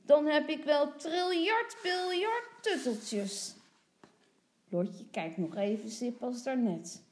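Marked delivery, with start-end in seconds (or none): 0.1-3.6 put-on loud, high childish boast; cheering little boy. A narrator's reading. Tommy dreams of endless comforters, crowing. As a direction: crow it, stretching the huge numbers. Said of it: "roept Tommy".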